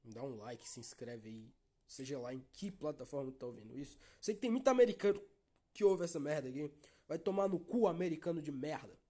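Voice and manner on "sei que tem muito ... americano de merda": yelled, much louder and higher, in jest; mock angry shout. Podcaster jokingly yells at American listeners, mock furious shout. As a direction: blast the insult out in mock rage.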